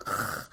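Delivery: low, quiet "ugh"; acted-out grunt of frustration; exasperated groan. Retold exasperation at picky child.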